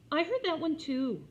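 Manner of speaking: put-on high, loud know-it-all child; smug little girl. A narrator's reading. Jenny rejecting another book, high child whine. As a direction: whine it like a bored kid.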